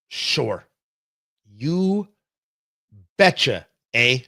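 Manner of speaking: light mock Canadian accent, slow and drawn out; a slow, jokey hint of Canadian "eh". Radio host ribbing Trudeau, slow light Canadian accent. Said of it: "makes me wanna watch Strange Brew".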